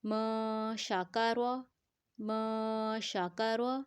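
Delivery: "م" held two seconds before "شاکا روا"; elongated nasal recitation. Shown as a demonstration. Teacher shows a two-count hold, elongated nasal recitation. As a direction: stretch the hum of "م", then say "شاکا روا" evenly. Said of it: "دو حرکات کے برابر ہم نے لمبا کرنا ہے".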